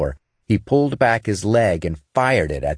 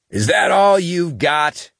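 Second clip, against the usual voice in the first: high, slow taunting yell; defiant shout; 11 semitones higher, 3 dB louder, slower than usual. A narrator's reading. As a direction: shout the challenge, spitting defiance. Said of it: "he shouted".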